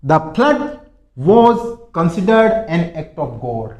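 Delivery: very slow and loud; slow teacher demo; slow model sentence. Shown as a demonstration. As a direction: read it out word by word. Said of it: "देखो".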